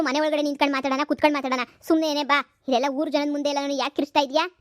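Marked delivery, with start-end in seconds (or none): none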